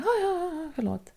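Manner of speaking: a hint of a cowed colleague backing down; meek apology. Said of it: "Och hon bara".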